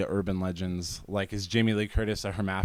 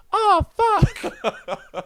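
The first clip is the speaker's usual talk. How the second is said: very high, loud mock squeal; impression of a friend; 7 dB louder than usual. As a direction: squeal it in mock dismay, far above your own voice. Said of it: "there was a great impression of him".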